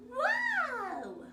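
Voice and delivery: ordinary delivery, nothing marked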